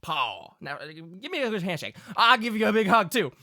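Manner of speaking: insistent folksy country father; hearty Southern Pa. Acted. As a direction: wave off formality like a Southern dad. Game master plays Pa.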